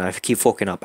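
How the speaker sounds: ordinary delivery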